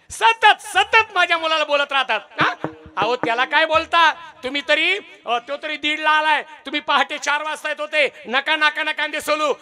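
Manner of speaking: a lightly voiced mother warning her husband; mother scolding. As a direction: defend the son sharply.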